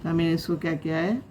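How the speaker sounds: normal talking voice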